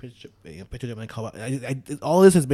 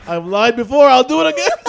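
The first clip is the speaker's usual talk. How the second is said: high, loud, cracking up; laughing confession; 11 semitones higher, 13 dB louder than usual. Retold or imitated. Teller joking about lying about his religion, laughing. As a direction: blurt it out through laughter.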